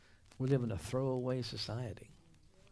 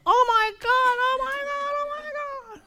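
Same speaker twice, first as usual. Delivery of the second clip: squealing excitement; 9 dB louder than usual. Retold or imitated. Imitating a believer thrilled by God's promise, squealing high. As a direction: squeal like a giddy fan.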